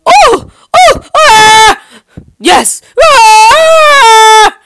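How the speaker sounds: loud high strained cries; silly mismatched redub. Acted. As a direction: yell it like straining to win. Struggle and victory cries.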